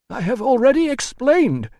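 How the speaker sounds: high, loud, fussy voice; exasperated administrator. A narrator's reading. Administrator explaining to the doctor, high and exasperated. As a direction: protest in a high official voice.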